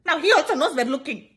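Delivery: ordinary talk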